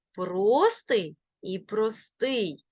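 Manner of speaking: простий stressed on про, then on стий; double stress. Shown as a demonstration. Teacher showing both allowed stresses, double stress. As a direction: slowly say простий front-stressed, then end-stressed. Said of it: "можуть наголошуватися бінарно".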